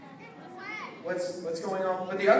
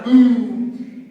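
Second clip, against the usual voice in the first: loud boom; 3 semitones higher, 11 dB louder than usual. Acted. Leader mimicking the balloon explosion, loud shouted boom. Balloon bursting in object lesson.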